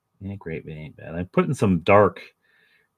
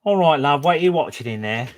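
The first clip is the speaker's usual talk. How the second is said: cheery mock British accent, higher and louder; 8 semitones higher, 5 dB louder than usual. Shown as a demonstration. Redoing his question to Ian in a British accent. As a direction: play it as a chirpy Londoner in a British accent. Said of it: "Except with a British accent".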